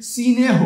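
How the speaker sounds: স্নেহ said as si-neh, a short i slipped in before the s-n cluster; vowel added before স্নেহ. Shown as a demonstration. Teacher showing students the added vowel, স্নেহ spoken as si-neh. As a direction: split স্নেহ into two syllables, sinéh, with an i up front. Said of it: "আগে মানে পূর্বে স্বরের আগমন".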